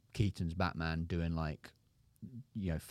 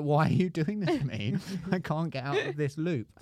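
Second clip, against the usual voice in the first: loud, high-pitched protest; exasperated comic outburst; mock exasperation; 9 semitones higher, 5 dB louder than usual. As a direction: wail it like a trapped, put-upon fan. Acted exasperated complaint at studios.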